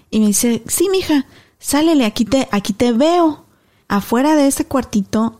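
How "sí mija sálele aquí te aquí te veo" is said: higher, gentle grandma voice; grandma's reply. Retold or imitated. Abuelita voiced in story.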